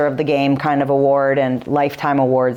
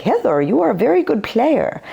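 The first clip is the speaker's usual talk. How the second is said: imitating a French coach's accent, pitched up; a light French-accented compliment; Arsene Wenger's French accent; 8 semitones higher than usual. Former coach praising her play, in a French accent. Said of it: "in his, you know, French accent".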